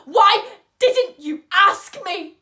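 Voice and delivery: high, very loud, slow angry demand; furious shout. Acted. Patient demands to know why Vivi never asked, furious shout. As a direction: shout it out, hammering each word slowly.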